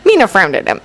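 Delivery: ordinary delivery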